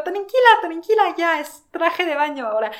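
high, loud urgent reassurance, easing off; calming friend. Retold or imitated. Retelling how she calmed her friend, high loud reassurance. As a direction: soothe her fast and loudly, then settle.

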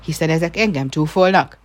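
loud indignant cry; angry shout. Acted. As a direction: yell it, insulted. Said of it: "kiáltotta".

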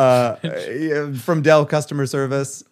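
a hint of an Indian call-center accent; just a touch of the Indian accent; light Indian accent. Teller mimicking the Indian scam caller's accent. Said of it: "I really wanted to do the accent".

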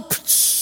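hissed "psh" of water; breathy splash noise; imitated splash. Boy lands in the pool, imitated splash.

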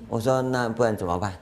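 usual speaking voice

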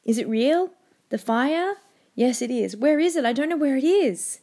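lightly voiced anxious boy; scared boy. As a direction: voice the frightened son begging his father for reassurance. Teacher voicing the son's pleading.

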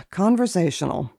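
ordinary talk

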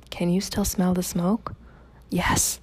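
hushed whisper, barely voiced. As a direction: whisper so no one hears. Women hiding quietly after riot.

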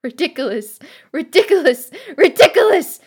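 desperate crescendo to a full shout; escalating desperation. Acted. Desperate spell repeated, building.

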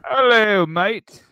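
high-pitched put-on British accent; mock British greeting. Greeting the absent British friend, mock British accent. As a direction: chirp it in a hammed-up British voice. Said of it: "British fifteen hundred checking in".

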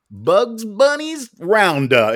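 drawn-out, high doom prediction; mock dread. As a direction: stretch it out in mock despair. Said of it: "And now I'm like".

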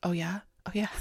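breathy, creepy whisper. Retold or imitated. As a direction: whisper it suggestively.

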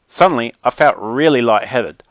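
ordinary delivery, nothing marked

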